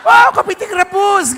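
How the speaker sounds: very loud, high, stretched mock curse; mock angry. Comic cursing with a dish name, bellowed mock rage. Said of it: "kalau marah".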